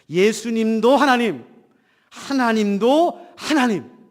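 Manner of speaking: ordinary talk, nothing marked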